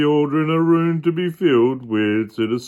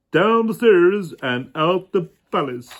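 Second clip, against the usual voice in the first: plain reading voice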